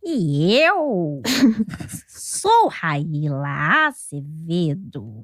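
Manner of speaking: low, slow hag voice with cackle; slow witchy croak; witch voice. Acted. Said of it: "Foi uma voz de bruxa?".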